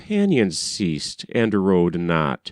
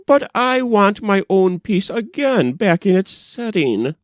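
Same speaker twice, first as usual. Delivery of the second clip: higher mimicked girlish insistence; king quoting the girl's refusal in a raised girlish voice; king imitating the maiden; 8 semitones higher than usual. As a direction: echo her stubborn demand in her voice. King retells the girl's words.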